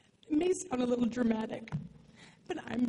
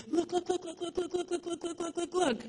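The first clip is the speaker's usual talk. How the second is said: excited dad; 7 semitones higher than usual. Retold or imitated. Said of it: "him saying".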